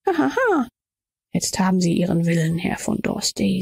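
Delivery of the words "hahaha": high staged "hahaha"; high-pitched performed laughter; compulsive laugh, acted. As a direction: laugh out high as if you cannot stop. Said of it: "Ich muss nur immer lachen".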